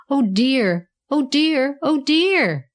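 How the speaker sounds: storybook fretting, building to a high wail; mounting dismay. A narrator's reading. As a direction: wail it gently, rising in pitch. Tom in a dilemma, exclaims thrice.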